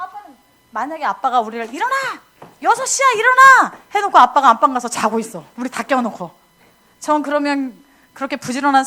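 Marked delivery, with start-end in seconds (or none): 1.7-3.7 high loud shout building; father shouting wake-up. Retold or imitated. Father waking kids at six, loud shout. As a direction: call it out through the door.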